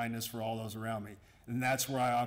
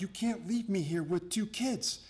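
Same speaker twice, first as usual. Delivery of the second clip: higher, slower mock-exasperated wife voice; imitating annoyed wife; 7 semitones higher than usual. Husband retells his ticked-off wife's complaint, higher and slower. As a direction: play his wife, fed up and left with the kids. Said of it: "My wife got ticked off".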